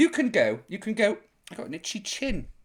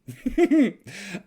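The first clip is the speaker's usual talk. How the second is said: laugh. Real, not acted. Laughs after the quote.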